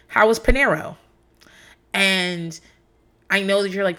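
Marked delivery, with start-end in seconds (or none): 0.1-1.0 "Panero" with an O ending, higher pitch; husband's mispronunciation. Retold or imitated. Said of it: "he would be like".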